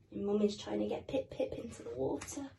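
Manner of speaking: ordinary delivery, nothing marked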